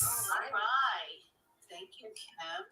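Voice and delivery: faint echo from a speakerphone; distant, tinny playback through a phone. Real, not acted. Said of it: "So that's me with the loud phone".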